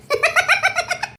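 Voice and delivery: high, soft giggle that trails on; giggling. Acted. Giggles at teasing about uncle.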